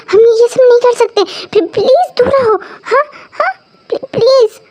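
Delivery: high, tearful, stammering begging; frightened pleading. Acted. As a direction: plead with him, whimpering and catching breath. Begging him to stay away.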